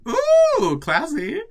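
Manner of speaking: very high, loud sarcastic ooh; mock impressed. Reader mocking the fancy shower, sarcastic squeal. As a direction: fake being dazzled.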